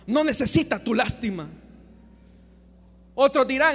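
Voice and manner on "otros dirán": ordinary talk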